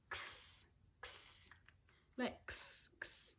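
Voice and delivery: x sound hissed, unvoiced; sounding out words. Shown as a demonstration. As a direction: repeat the x sound breathily. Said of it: "take an x-ray".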